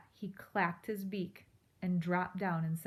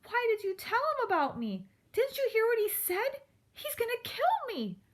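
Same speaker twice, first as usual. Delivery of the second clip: frightened mouse; 5 dB louder than usual. Acted. Frightened mouse accuses porcupine.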